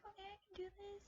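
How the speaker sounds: high, soft little pep talk; retold nervous self-encouragement, high and soft. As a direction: psych yourself up in a small high voice. Psyching herself up on obstacle course.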